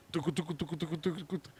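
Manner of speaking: very soft playful tucu tucu sounds; soft sucking noise. Retold or imitated. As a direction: mimic sucking pain away quietly. Imitating sucking out the pain. (imitación suave de succionar)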